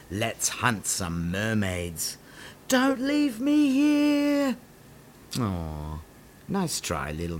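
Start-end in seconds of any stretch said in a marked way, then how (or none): 2.7-4.6 high, slow, desperate plea; pleading. Acted. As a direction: beg them not to go. Small pirate abandoned.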